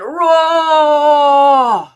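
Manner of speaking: very loud, high, long-held "roar"; lion's roar. Shown as a demonstration. Coach leads the lion's breath exhale, full-force roar. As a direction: bellow it out on one full exhale. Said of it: "I'm gonna say roar".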